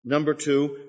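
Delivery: normal talking voice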